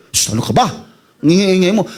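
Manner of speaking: hushed scolding shh, then a sharper rebuke; shushing crowd. Acted. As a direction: hush him down impatiently. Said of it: "tumigil ka nga". (pagsaway ng mga tao)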